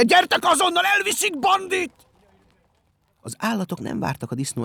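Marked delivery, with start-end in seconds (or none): 0.0-2.1 high, loud, desperate yell; frantic roar that rallies everyone; bellowed alarm. A narrator's reading. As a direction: yell for help at full volume. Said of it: "üvöltötte".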